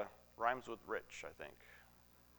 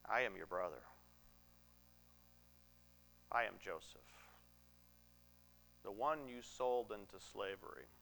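low, soft, solemn confession; hushed revelation; 6 semitones lower, 9 dB quieter than usual. Retold or imitated. Joseph revealing himself to his brothers, hushed and solemn. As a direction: reveal it quietly, with long pauses.

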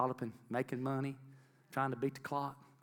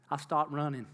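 ordinary delivery

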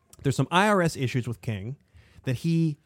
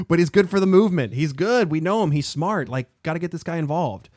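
insistent advocacy; 5 semitones higher, 6 dB louder than usual. Retold or imitated. Voicing King's case for hiring Rustin.

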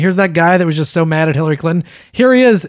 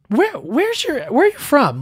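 high-pitched imitation of a curious question; lightly voiced classmate; 10 semitones higher, slower than usual. Girl in class asks Joey where he's from, lightly mimicked. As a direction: ask it high, stumbling over the start.

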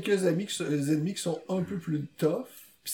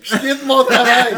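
louder, slower, laughing through it; 6 semitones higher, 10 dB louder than usual. Real, not acted. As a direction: laugh it out, slow and loud. Joking repeat, louder laughing.

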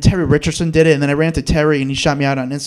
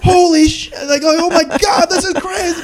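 much higher, awed exclaim; stunned excitement; 13 semitones higher, slower than usual. Retold or imitated. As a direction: let the shock lift your voice. Shocked reaction to being on TV.

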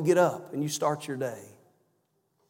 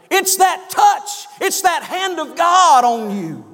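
fiery preaching; 9 semitones higher, 5 dB louder than usual. Acted. Preacher building fervour before his congregation, full-force preaching. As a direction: preach it with the hand of God behind it.

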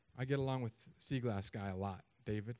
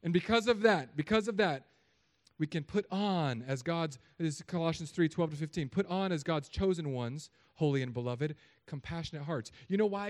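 loud, raised preacher's voice; forceful preaching; 4 semitones higher, 7 dB louder than usual. Real, not acted. Preacher urging church to put on compassionate hearts, forceful. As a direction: drive the point home to the congregation.

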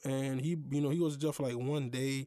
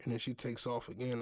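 ordinary delivery

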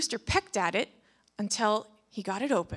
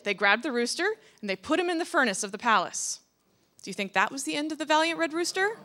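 ordinary delivery, nothing marked

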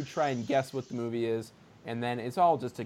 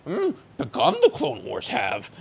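Yoda voice; 9 semitones higher than usual. Retold or imitated. Quotes Yoda's backwards speech.

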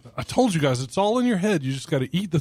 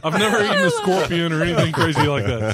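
laughing; 5 semitones higher, 4 dB louder than usual. Real, not acted. Laughs answering question.

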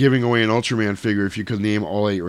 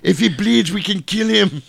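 high-pitched comic action-star impression; Arnold impression; 8 semitones higher than usual. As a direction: play an exasperated Austrian action star, pitched up. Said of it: "And Arnold's like".

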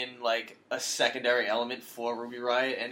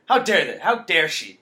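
louder, higher, hammed-up indignation; mock outrage; 3 semitones higher, 9 dB louder than usual. Podcast host fakes shock at Ruby Riott, mock outrage. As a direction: play the scandalised fan, tongue in cheek.

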